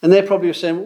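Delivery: ordinary delivery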